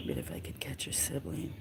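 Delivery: low whisper to herself. Real, not acted. Owner whispering to the kitten about its sibling, whisper. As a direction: whisper it under your breath.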